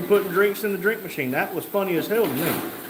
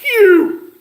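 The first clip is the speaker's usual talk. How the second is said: high, very loud holler; all-caps shout; 12 dB louder than usual. Acted. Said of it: "Mike said in all caps".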